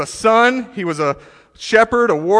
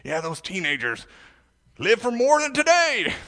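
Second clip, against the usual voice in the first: mock voice rising high at the end; sarcastic adults; 4 semitones higher, 5 dB quieter than usual. Preacher mocking adults who blame teenagers, sarcastic. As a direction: sneer like a smug grown-up. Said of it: "throw it on the teenagers".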